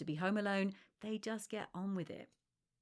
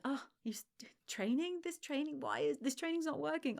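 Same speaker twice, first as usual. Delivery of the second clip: higher, partly whispered mocking voice; mock smug friend; 7 semitones higher than usual. Friend sneering at failed training, mocking.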